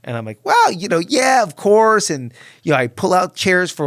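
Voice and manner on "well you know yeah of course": high, loud eager yes; overeager, drawn out agreement. Retold or imitated. Eager reply to priest.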